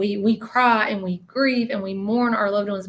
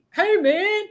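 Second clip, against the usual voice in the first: much higher, bright-toned hey; buddy greeting; 12 semitones higher, 3 dB louder than usual. Retold or imitated. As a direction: greet a friend casually from afar. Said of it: "he'd be like".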